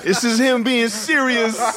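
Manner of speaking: high, drawn-out, through laughter; laughing disbelief. Real, not acted. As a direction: crack up while announcing it slowly. Announcing it amid helpless laughter.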